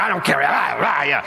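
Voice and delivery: careless slurred mock farmer voice; mock angry farmer. Pastor mocking the sower as a careless farmer. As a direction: ham up a farmer who doesn't care. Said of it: "Angry farmer, he's just like".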